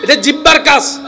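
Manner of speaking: high, loud shouting sermon; fiery preacher at full voice; full-force preaching. Acted. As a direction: hammer each point to the crowd.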